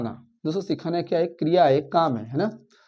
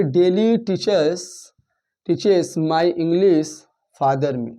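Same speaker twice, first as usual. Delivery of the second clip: reading out each word slowly, one by one; much slower than usual. Shown as a demonstration. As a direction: spell the scrambled sentence out word by word. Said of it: "यहाँ पे एक sentence है".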